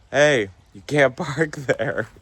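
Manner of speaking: laughing taunt. Retold or imitated. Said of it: "they go".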